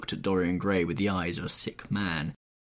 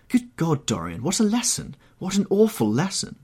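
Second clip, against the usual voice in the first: loud, high cry of dismay; horrified exclamation; 10 semitones higher, 5 dB louder than usual. Acted. Painter collapses in chair, crying out in horror. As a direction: cry out, appalled.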